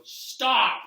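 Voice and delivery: high, loud imitated shout; yelled stop. Commentator Brian crying out mid-match, yelled. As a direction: yell it out like the announcer. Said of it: "you hear Brian go".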